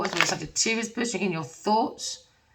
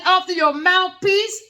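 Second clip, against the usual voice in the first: laughing quote; 10 semitones higher, 6 dB louder, slower than usual. Acted. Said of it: "He said".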